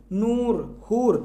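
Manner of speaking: नूर and हूर slowly, each oo drawn out long; slow demo of नूर, हूर with long open oo. Shown as a demonstration. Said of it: "यानी देर तक आवाज़ निकले".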